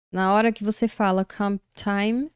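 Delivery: ordinary delivery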